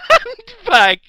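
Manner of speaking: high squealing laugh; laughing shriek. Real, not acted. GM shrieks laughing at kitten handbag.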